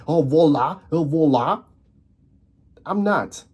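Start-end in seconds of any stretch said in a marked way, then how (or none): 0.1-1.6 a put-on Arabic street accent saying "voila"; mock an Arabic-Dutch accent, "wallah"; mock Arabic-Dutch slang. Mocking posers to viewers, a put-on Arabic-Dutch accent. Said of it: "act like I'm fucking, uh, Arabic".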